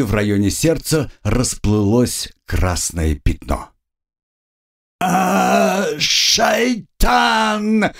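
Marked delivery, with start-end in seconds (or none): 5.0-7.9 high-pitched, drawn-out cry; yelled curse. A narrator's reading. Wounded bandit lying on the floor, yelling a curse. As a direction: yell it out, stretching the word high. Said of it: "заорал лежащий".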